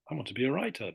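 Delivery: British accent with a crisp T in "writer". Shown as a demonstration. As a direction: sound every T, British-style.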